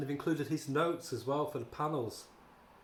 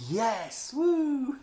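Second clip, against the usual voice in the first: hammed-up joyful whoop, much higher and louder; mock cheer; 10 semitones higher, 5 dB louder than usual. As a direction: cheer like a girl whose car finally starts. Character finds a working car.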